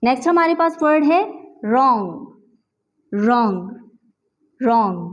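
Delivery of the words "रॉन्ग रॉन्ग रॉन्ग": रॉन्ग said "rong", no w; silent w in wrong. Shown as a demonstration. Teacher modelling wrong with silent w. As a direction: start रॉन्ग on r. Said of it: "डब्ल्यू हमारे पास जो है वो silent है".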